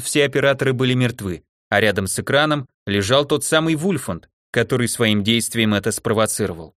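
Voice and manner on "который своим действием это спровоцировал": usual speaking voice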